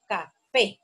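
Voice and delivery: "café" slowly split, ca... FÉ; syllables, stress on FÉ. Shown as a demonstration. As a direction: separate ca from a strong FÉ in "café". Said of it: "termina en vocal".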